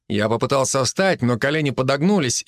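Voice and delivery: usual speaking voice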